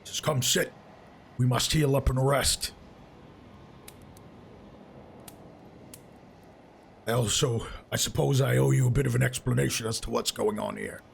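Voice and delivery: lightly voiced companion, inviting then explaining; a hint of a character voice, settling the party down; Zal. Game master plays Zal.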